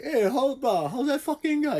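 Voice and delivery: mock careless talk around kids; lightly acted crude banter; casual swearing. Imagined swearing at school.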